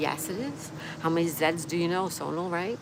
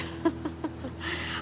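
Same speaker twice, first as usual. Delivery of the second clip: short high airy laugh; 10 semitones higher than usual. Real, not acted. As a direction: laugh lightly, high and unvoiced. Amused by weed whacking chat.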